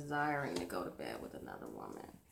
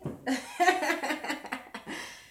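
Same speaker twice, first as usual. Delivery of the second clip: high unvoiced giggle; breathy burst of laughter; laughing; 10 semitones higher, 5 dB louder than usual. Real, not acted. Laughs at own taxi joke.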